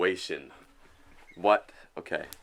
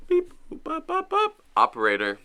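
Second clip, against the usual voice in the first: high squeaky beep boop sounds; dial tone beeps; 6 dB louder than usual. Pretend. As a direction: chirp the dial tones high. Mimicking phone dial tones.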